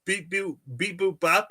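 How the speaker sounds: beep-boop like a little alien; robot beeps. Retold or imitated. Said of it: "I said".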